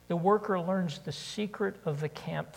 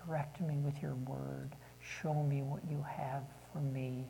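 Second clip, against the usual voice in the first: prayer. Real, not acted. Leads closing prayer.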